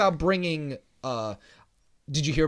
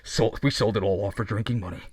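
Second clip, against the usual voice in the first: hollow talking mask; 10 semitones lower than usual. Pretend. Mock villain voice play.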